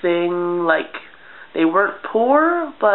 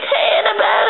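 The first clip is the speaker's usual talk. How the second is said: much higher call across the yard; 13 semitones higher than usual. Retold or imitated. Child calling to her friend from the window, high call. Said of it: "be like".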